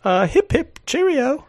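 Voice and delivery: high, hammed-up posh British voice; mock upper-class English; British accent. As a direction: toff it up, very proper British. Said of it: "How's your accent?".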